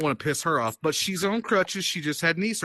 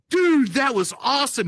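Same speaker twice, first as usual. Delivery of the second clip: excited awe; 8 semitones higher, 4 dB louder than usual. Retold or imitated. Reacts to fireball hitting woman.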